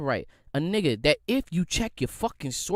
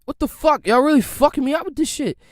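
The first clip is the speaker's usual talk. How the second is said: furious shout; 10 semitones higher, 9 dB louder than usual. Retold or imitated. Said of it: "I'm like".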